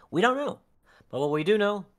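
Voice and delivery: high, loud hype build; excited. Real, not acted. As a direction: pump up the crowd.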